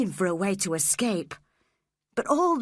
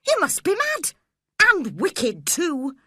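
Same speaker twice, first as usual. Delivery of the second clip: angry outcry; 9 semitones higher, 5 dB louder than usual. A narrator's reading. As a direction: scold him furiously. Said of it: "I cried".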